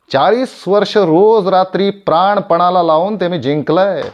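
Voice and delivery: high, loud proud outburst; impassioned claim. Acted. Actor claiming forty years of hard-won love, impassioned and loud. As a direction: proclaim it with full force.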